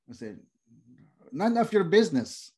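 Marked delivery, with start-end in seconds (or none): 1.3-2.5 curt mock refusal.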